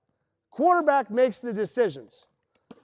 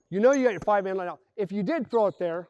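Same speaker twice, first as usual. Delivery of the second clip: ordinary talk, nothing marked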